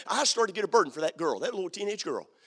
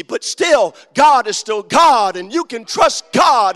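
preaching at full force; 2 semitones higher, 9 dB louder than usual. Acted. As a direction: hammer each line to the rafters. Preacher rising on trials.

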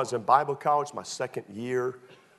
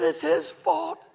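high, slow whine of blame; drawn-out, high-pitched kid's protest; protesting little kid; 4 dB louder, slower than usual. Retold or imitated. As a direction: imitate a child pleading his case. Acts himself as protesting child.

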